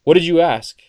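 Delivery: loud, sharp mock demand; demanding handler. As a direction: burst in and demand answers.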